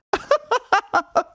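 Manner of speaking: a sudden high burst of disbelieving laughter; incredulous laugh. Real, not acted. Laughs at the paper's claim.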